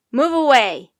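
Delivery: very loud sailor's order; loud command at normal pitch; yelling. A narrator's reading. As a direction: shout people back forcefully. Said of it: "yelled a sailor".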